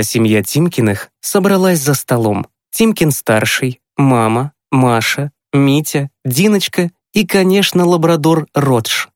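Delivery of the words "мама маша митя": ordinary delivery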